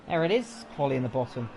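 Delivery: ordinary delivery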